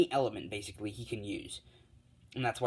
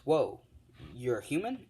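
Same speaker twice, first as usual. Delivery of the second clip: astonished; 2 semitones higher than usual. Retold or imitated. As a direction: react amazed, lifting into a question. All Might sees the human form.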